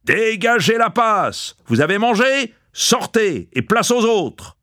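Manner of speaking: high, drawn-out commanding shout; roaring from the doorway. A narrator's reading. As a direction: order the crowd out, high and imperious. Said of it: "rugissait depuis la porte".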